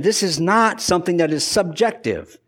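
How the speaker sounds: normal talking voice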